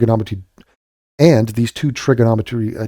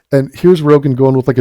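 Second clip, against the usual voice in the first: ordinary delivery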